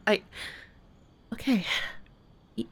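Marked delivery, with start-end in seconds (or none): none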